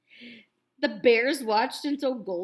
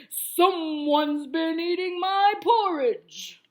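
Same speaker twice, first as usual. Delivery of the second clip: slow, loud bear roar; roaring Papa Bear; 5 semitones higher, 9 dB louder, slower than usual. A narrator's reading. Papa Bear finding his porridge eaten, slow loud roar. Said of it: "roared Papa Bear".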